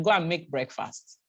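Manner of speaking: ordinary talk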